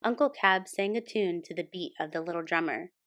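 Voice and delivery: plain reading voice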